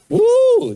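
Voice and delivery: sudden high loud woo; a sharp startled whoop. Real, not acted. Dogs startle the toddler.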